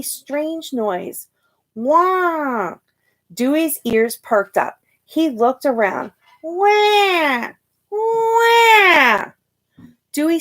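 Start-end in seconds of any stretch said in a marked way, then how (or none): 1.7-2.8 put-on high wailing baby cry. A narrator's reading. A baby in the library crying out. 6.4-9.3 put-on baby wail, rising higher and louder; baby cry. A narrator's reading. A baby in the library, wailing louder each time.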